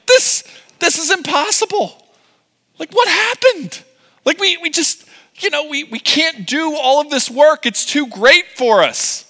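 very high, hammed-up whining plea; distressed protest. Foreman protests to the Egyptian taskmasters, distressed. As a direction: squeal the complaint like an overwhelmed worker.

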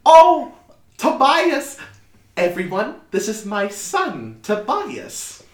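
high, loud mother introducing her son; high-pitched, effusive motherly voice; proud mother. Acted. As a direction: present your son in a high voice.

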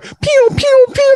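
high, sharp pew pew sounds of fireworks; playful firework sound effects; fireworks pew noises. Retold or imitated. Imitating fireworks going off.

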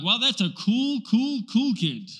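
"cool, cool, cool" said slowly, each one stressed; triple emphasis. Shown as a demonstration. Preacher imitating a Hebrew kid's triple emphasis, slow stressed "cool". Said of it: "They'd be like".

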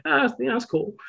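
high, offhand shrug of a line; mock indifference, not impressed; a lightly voiced meh; underwhelmed. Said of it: "am I just kinda like".

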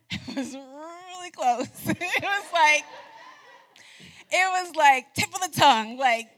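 talking through bursts of laughter, pitched high; laughing. Real, not acted. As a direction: laugh while admitting how close it came. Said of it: "y'all, I'm laughing".

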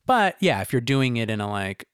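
normal talking voice

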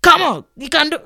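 loud, high-pitched trainer's urging; screaming trainer. Retold or imitated. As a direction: yell short commands like a trainer pushing a client. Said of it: "the person will be screaming".

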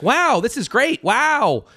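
high, gushing mock praise; imitating a gushing moviegoer; mock excited gush. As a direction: ham up the chatty viewer. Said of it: "He's like".

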